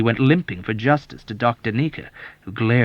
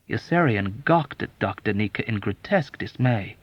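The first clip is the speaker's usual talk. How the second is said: ordinary delivery, nothing marked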